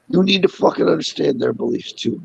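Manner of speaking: ordinary talk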